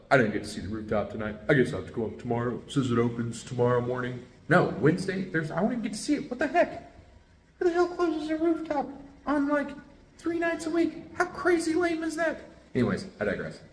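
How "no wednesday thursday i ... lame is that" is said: much higher, indignant disbelief; exasperated rant. Real, not acted. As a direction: squeal out how ridiculous it is. Ranting about closed rooftop.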